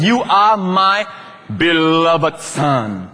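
very slow, half-sung You are my beloved son, lingering on beloved; the Father's tender declaration, dwelt on; drawn out beloved. Shown as a demonstration. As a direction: savour beloved like a lullaby. Stressing the Father's full words.

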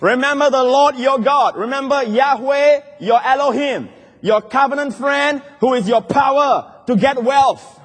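high, emphatic exhortation; rousing pulpit charge; full-force preaching. Real, not acted. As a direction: drive each phrase home to the congregation.

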